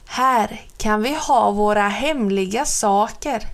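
ordinary delivery

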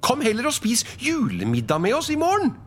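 very high, quick, eager invitation; disarming, cheery. Acted. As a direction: invite him in with a smile. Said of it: "smilte Fred avvæpnende". (avvæpnende, lys og ivrig invitasjon)

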